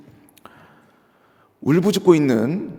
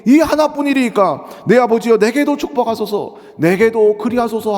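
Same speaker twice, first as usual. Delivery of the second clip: raised, higher pleading cry; loud pleading; 6 semitones higher, 5 dB louder than usual. Acted. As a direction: beg your father loudly. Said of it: "소리를 높여 울었다고".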